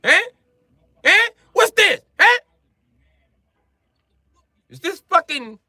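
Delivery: hammed-up Italian accent, high and indignant; an outraged Italian cook scolding; angry Italian. Comic plays an Italian furious about processed cheese, mock Italian accent. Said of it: "Italians be mad about it".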